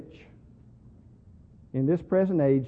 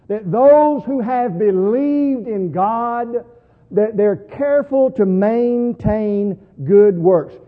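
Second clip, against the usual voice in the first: very loud, slow, raised-pitch proclamation; preaching with authority; 6 semitones higher, 13 dB louder, much slower than usual. Acted. Preacher showing Titus what to preach, with great authority. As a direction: drive every word home with weight. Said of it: "preach this with great regularity and authority".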